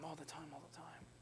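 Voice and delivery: hushed, breathy whisper. Real, not acted. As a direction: whisper the words as if haunting. Echoing the self-told lie.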